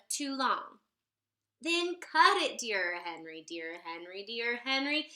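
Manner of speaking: usual speaking voice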